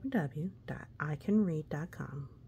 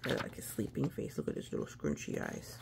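tender coo; 8 semitones lower, 5 dB quieter, faster than usual. Real, not acted. Cooing at sleeping face.

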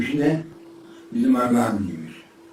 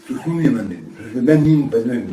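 indignant laughing protest; 2 semitones higher, 8 dB louder than usual. Retold or imitated. Retells protesting to the police chief.

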